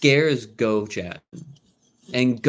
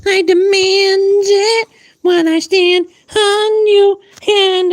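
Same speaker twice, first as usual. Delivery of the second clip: very high, loud, slow squeaky pet voice; a much higher, slow bossy pet voice, louder; demanding pet voice; 10 dB louder, slower than usual. Pretend. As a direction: voice the cat as a tiny high tyrant. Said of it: "He's like".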